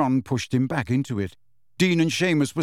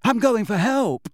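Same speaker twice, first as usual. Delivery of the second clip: frightened, higher and louder call; scared; 9 semitones higher, 4 dB louder than usual. A narrator's reading. Neville running for help, scared and loud. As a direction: rush the words out in fear. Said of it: "said the same scared voice".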